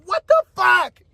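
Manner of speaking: high-pitched cry of disbelief; retold exasperated outburst. Man reliving the discovery of her boyfriend, exasperated outburst. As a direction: blurt it, utterly baffled.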